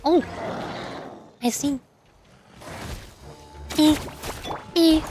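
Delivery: ordinary delivery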